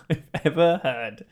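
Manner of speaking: normal talking voice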